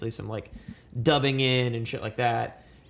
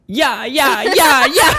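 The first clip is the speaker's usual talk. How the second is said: loud high laughing cheer; hype man; 11 semitones higher, 13 dB louder, slower than usual. Real, not acted.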